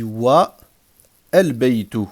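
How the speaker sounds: "wa", a clear stop, then "al-baytu" with its a sounded; pause before al. Shown as a demonstration. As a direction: break between "wa" and "al-baytu". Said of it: "si je marque un arrêt".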